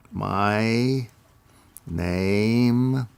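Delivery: very slow, stretched-out syllables; measured stuttering-school delivery; slow therapy speech. Shown as a demonstration. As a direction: draw each word out, one at a time. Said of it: "Very slow".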